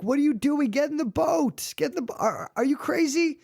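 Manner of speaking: very high, incredulous pleading; acted rescuer at wit's end, pitch way up; frantic urging. Boat rescuer urges drowning man aboard, frantic.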